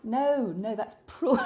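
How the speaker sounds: normal talking voice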